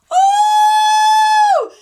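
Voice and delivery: high, loud delighted squeal; sudden thrilled shriek; excited squeal. Real, not acted. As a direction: squeal with glee at the package. Spots a fun package.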